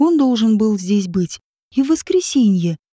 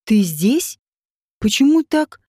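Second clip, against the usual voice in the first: rising, mildly astonished questions; surprised questioning. A narrator's reading. Слава to Родион at the river, surprised questioning. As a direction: question him in puzzled surprise. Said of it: "удивлённо спросила Слава".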